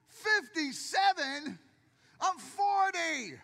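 play-shout it like you're wounded; mock outrage. Mock outrage at being called 57.

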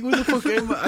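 high, loud panicked question; mock panic on waking in a strange car; panicked retelling. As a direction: relive waking up lost and scared.

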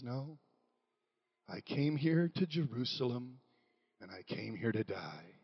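low, soft, grave reply; as Jesus, quietly correct the hopeful disciples; solemn answer. Retold or imitated.

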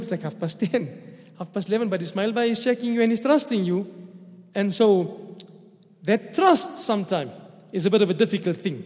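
ordinary delivery

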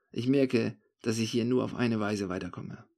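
plain reading voice

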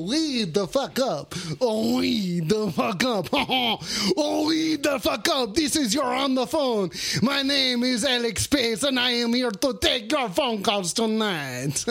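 hammed-up French host with mock chuckles; attempted French accent. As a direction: play a silly French radio host. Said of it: "I don't know how to do a French accent".

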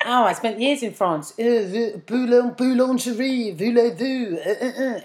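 mocking attempt at bad French, stumbling over words; appalling French accent. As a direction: imitate Jamie's dreadful French accent with hesitant uhs. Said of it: "his accent is appalling".

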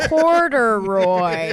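Corduroy stretched very slowly; drawn-out word. Retold or imitated. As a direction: draw out Corduroy slowly, savoring it. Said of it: "saying it into the microphone".

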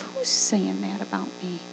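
low, hushed question; soft voice of private doubt; quiet self-questioning. Acted. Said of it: "ask yourself".

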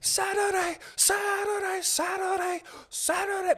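high-pitched chanted announcer yell; hype event announcer. Acted. Imagining knights jousting on horseback, hype announcer shout. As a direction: holler it like a monster-rally promo.

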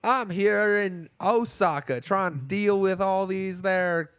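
high, slow, hammed-up Hollywood Southern drawl; Hollywood Southern accent. Mocking the English dub in a slow, high Hollywood Southern accent. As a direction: ham up a cowboy accent, slowly. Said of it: "really hard to get through the".

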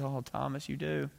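soft, gentle reassurance; calming. Retold or imitated. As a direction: quietly settle an alarmed friend. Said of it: "Jesus calms him down".

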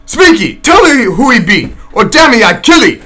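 a cannibal demanding an answer, furious; growling cannibal. Acted. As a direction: snarl the threat at him. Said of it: "again growled the cannibal".

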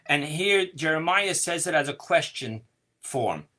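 ordinary talk

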